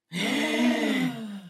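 high mock-dramatic gasp; a hammed-up gasp mid confession; theatrical gasp. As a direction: gasp like a soap-opera heroine. Melodramatic lyric recital to boyfriend.

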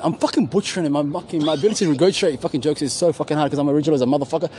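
ordinary delivery

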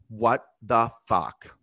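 drawn-out, word-by-word disbelief; retold slow disbelief. Girl confronts him after standing up, imitated slow disbelief. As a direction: stretch each word slowly, imitating the girl.

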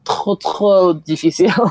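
slow, playful French phrase with a laugh; French accent. Real, not acted.

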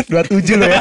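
teasing through laughter; laughing. Real, not acted. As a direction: laugh while you tease. Laughing tease. (tertawa sambil meledek)

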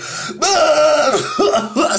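high, hacking mock cough-laugh; imitated coughing fit. Old man choking on a toke, mock coughing fit. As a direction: act out choking on the smoke. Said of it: "still fucking dying".

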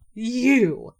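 a startled, loud, high "You!"; surprised. A narrator's reading. Chief to boastful Rabbit, loud shocked disbelief. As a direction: blurt it out in disbelief. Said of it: "said the chief in great surprise".

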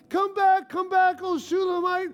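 high, loud call after someone leaving; friends yelling out. Acted. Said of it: "they're yelling out".

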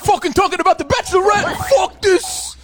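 high, whiny annoyed listener. Retold or imitated. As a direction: scoff like a fed-up fan. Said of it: "people would have said".

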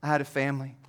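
soft, low, heavy with loss; hushed lament of a man who lost everything; sorrowful leper plea. Pretend. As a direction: speak as a grieving outcast, quietly. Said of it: "and I would say".

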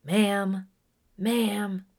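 retold stranger yelling ma'am; imitating a shout. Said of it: "he screamed at me".

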